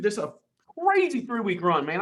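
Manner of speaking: ordinary delivery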